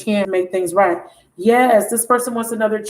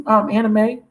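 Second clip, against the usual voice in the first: ordinary talk, nothing marked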